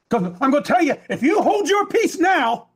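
high-pitched, driving sermon cadence; impassioned preaching. Real, not acted. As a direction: build the charge name by name, urgently. Preaches at full force.

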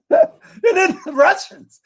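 high, slow, laughing it out. Real, not acted. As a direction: laugh through the words. Laughs then repeats higher.

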